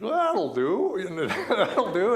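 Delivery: slow, loud Australian, laughing through it; Australian accent. Retold or imitated. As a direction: drawl it out as the satisfied Australian. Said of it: "put on this very heavy Australian accent".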